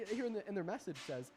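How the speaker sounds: usual speaking voice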